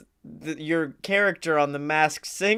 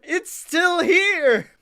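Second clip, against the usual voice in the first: excited; 10 semitones higher, much slower than usual. Retold or imitated. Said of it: "I was like".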